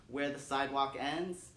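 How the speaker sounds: usual speaking voice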